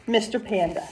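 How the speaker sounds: plain reading voice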